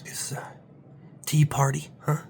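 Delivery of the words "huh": normal talking voice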